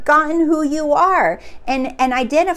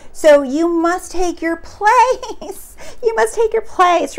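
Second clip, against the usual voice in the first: laughing; 7 semitones higher, 4 dB louder than usual. Real, not acted. Laughing, delighted repetition.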